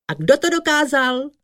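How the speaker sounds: high, quick rallying question; crowd call. A narrator's reading. Frogs ask who managed it, high excited call.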